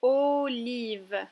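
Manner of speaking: "Olive" loud, long held O; syllable by syllable. Shown as a demonstration. Teacher repeats a word so the child hears O, drawn out. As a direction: stretch "Olive" out for a learner. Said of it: "J'aimerais que tu retrouves la syllabe".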